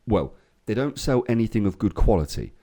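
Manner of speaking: ordinary talk, nothing marked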